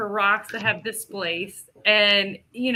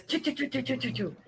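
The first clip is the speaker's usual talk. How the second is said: soft, rapid "choo" bursts like breaking branches; quiet mouth-made crashing noise; imitated branch crashing; 3 semitones higher, 9 dB quieter, faster than usual. Storyteller imitates a rock tearing through trees, soft crashing sound effect. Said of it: "breaking branches".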